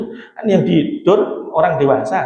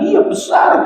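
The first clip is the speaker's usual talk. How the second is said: sheikh's stern reply; slower than usual. Retold or imitated. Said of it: "kata Syekhnya".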